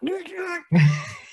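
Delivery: a high falling laugh; high burst of laughter. Real, not acted. Laughing at kids on phones.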